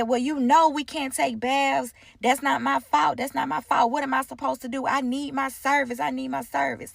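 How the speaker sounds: offended client. Retold or imitated. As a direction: imitate her insisting it's not her fault. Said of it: "So she said".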